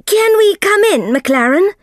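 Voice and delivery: called loudly through a closed door, high; shouting. Acted. Soames calls through McLaren's door, shouting loud. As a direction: shout it up the stairs to the locked room. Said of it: "Mister Soames shouted".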